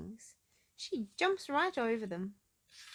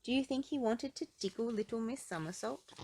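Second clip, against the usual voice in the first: ordinary delivery, nothing marked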